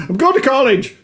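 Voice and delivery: high-pitched mock South African accent. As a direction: imitate an eager South African student. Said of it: "I'm from South Africa".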